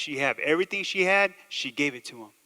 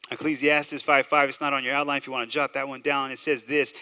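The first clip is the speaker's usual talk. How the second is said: ordinary talk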